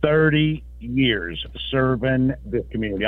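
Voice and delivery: ordinary talk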